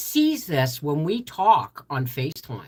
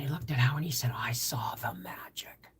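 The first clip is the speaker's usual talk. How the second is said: hushed whisper; 4 semitones lower, 8 dB quieter than usual. Retold or imitated. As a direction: whisper it like a secret wonder. Retelling magic moment hushed.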